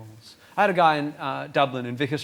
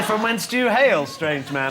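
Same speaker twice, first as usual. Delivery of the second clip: raised, loud theatrical inquiry; mock-archaic question; 5 semitones higher, 5 dB louder, slower than usual. Comedian answers the imagined heckler, mock-formal. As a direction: ask it grandly, then let it drop. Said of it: "I would ask him where he's from".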